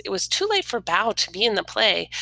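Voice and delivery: usual speaking voice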